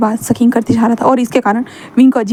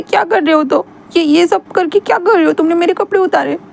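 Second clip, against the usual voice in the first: higher whiny voice, hurt, building to anger; sulky young man; 6 semitones higher than usual. Acted. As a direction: pout and complain like a wounded boy. Wing voiced.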